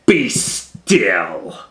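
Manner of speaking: loud, firm command. Acted. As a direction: order the monsters still. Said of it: "And Max said".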